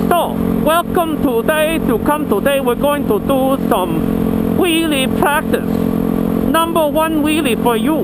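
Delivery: put-on playful Chinese accent, drawn out and sing-song; mock Chinese accent. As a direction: welcome the viewers in a jokey Chinese accent. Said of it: "So, Chinese".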